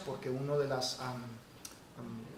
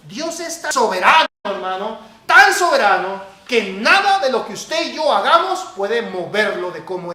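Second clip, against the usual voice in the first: loud, high, forceful preacher; emphatic preaching; 5 semitones higher, 14 dB louder than usual. Real, not acted. Preacher to congregation on God's sovereignty, forceful preaching. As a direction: drive it home with raised voice.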